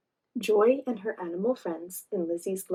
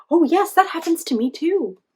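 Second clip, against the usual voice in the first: high, loud eager agreement; 8 semitones higher, 9 dB louder than usual. Acted. Torty voiced agreeing.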